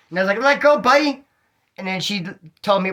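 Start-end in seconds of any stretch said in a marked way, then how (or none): 0.4-1.2 high, loud desperate yelp at the dog; panicked plea. Retold or imitated. Pinned under the pit bull, pleading at it high and loud. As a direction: beg the dog to let go.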